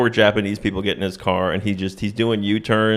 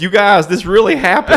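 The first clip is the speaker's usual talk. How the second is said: higher, louder mock-wounded protest; hammed-up insistence; mock earnest plea; 9 semitones higher, 5 dB louder than usual. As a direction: plead with a jeering crowd in comic earnest. Singer protesting to laughing crowd.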